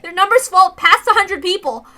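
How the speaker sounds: high loud agitated report; high loud frightened telling; frantic account. Acted. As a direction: warn them, voice rising and loud. Mion's frantic account of armed villagers.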